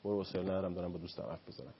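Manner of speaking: mock parent too busy to listen; brushing off. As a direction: wave the kid away, half-hearted and quick.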